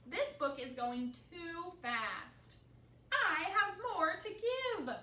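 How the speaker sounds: loud, slow, climbing to a high wail; distraught protest. A narrator's reading. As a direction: protest in hammed-up despair. Gerald protests the ending.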